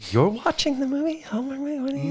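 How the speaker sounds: high-pitched disbelief; mock incredulity. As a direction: squeak it out, baffled by the very idea. Mock reaction to punk guy watching.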